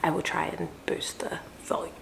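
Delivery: soft, hushed voice so nobody wakes; talking quietly. Real, not acted. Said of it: "I'm talking really quietly".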